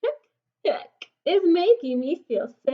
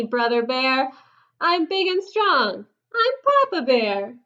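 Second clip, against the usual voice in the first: ordinary delivery, nothing marked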